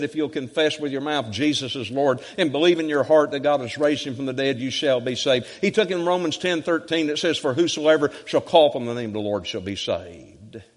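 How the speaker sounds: high, driving run of Bible verses; preaching scripture recital. Real, not acted. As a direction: build momentum verse upon verse like a preacher.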